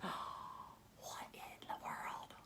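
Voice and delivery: slow, hushed gasping whisper; amazed whisper. A narrator's reading. Reader spots a clue, whispering in wonder. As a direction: breathe it in wonder. Gasp at the mystery.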